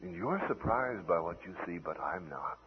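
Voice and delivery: soft, low, intimate reassurance; gentle near-whisper. Acted. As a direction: drop low and hushed, as if confiding. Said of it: "then he whispers to you".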